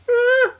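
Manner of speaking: tiny falsetto eh; high squeak. Pretend. Squeak and grunt routine.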